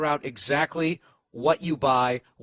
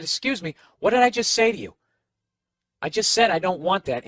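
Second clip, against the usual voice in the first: raised-voice retold scolding; loud, exasperated retelling of a telling-off; indignant confrontation; 4 semitones higher, 6 dB louder than usual. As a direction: demand an answer from the clerk, loudly.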